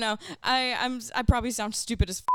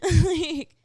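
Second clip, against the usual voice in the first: squeaky fry scream; 9 semitones higher, 9 dB louder than usual. Shown as a demonstration. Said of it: "squeaky toy".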